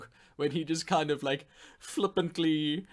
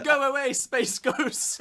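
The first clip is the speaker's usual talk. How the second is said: laughing jeer; 9 semitones higher, slower than usual. Retold or imitated. Mocking the character, laughing.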